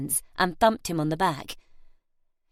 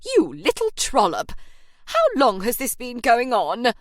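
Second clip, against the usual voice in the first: loud, scandalised matron's accusation; throbbing outrage; 3 semitones higher, 6 dB louder than usual. Acted. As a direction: boom it out like a shocked landlady. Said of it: "throbbed from the doorway".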